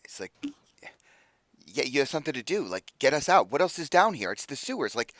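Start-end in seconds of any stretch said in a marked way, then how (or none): 1.6-4.9 higher-pitched, exasperated arguing; exasperated protest. Acted. Freed captive argues with rescuers about leaving the sewers, exasperated. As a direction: argue back, voice climbing in disbelief.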